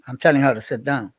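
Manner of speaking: usual speaking voice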